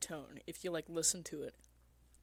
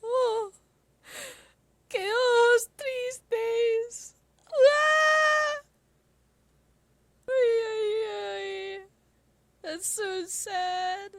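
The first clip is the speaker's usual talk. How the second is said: mock sobbing; 8 dB louder, much slower than usual. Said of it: "and I'm just like".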